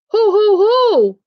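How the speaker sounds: high, slow, rolling storybook Santa; Santa's ho-ho-ho. A narrator's reading. Santa is found in Baby Bear's bed, drawn-out ho-ho-ho. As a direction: draw out each ho, pitched high.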